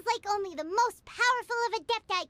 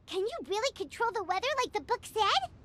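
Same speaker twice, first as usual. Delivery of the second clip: normal talking voice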